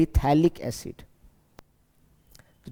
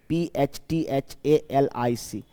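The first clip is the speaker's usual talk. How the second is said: slow letter-by-letter spelling; measured, spaced-out letters; slower than usual. Shown as a demonstration. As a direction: spell it out slowly, each letter separate. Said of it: "phthalic acid के नाम से".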